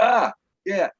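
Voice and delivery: choked-up, shaky sigh; recovering from tears; tearful. Real, not acted. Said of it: "I'm gonna cry again".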